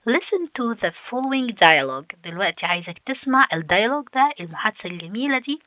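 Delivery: ordinary delivery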